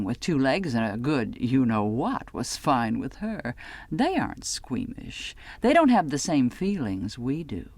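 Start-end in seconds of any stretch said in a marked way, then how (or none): none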